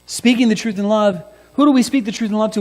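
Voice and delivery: fervent preaching. Acted. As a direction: preach it out to the church. Preacher opening the point.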